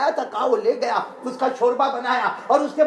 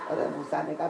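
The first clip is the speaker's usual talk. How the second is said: normal talking voice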